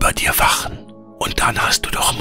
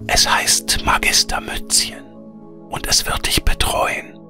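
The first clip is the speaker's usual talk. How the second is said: air spirit whispering. A narrator's reading. Air spirit bids Veronika farewell, in a whisper.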